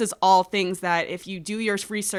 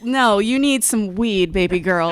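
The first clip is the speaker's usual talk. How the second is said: mock scolding; 4 semitones higher, 6 dB louder than usual. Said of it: "I'd be like".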